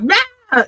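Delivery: high mock yell of rage; high-pitched imitated rage noise; angry outburst. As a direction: imitate an angry, wordless blurt. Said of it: "coming out in anger and rage".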